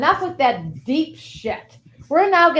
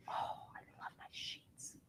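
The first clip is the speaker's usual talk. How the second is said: whisper; 26 dB quieter, slower than usual. Acted. Dreamy aside about her sheets.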